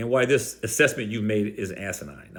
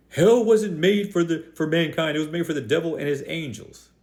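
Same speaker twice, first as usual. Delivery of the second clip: mocking mimicry; 4 semitones higher than usual. Preacher mimics what so many people wrongly say, mocking and higher. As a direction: parrot the mistaken claim in a raised voice. Mimics a common mistaken claim.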